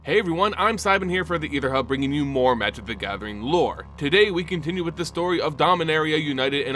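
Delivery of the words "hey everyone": ordinary delivery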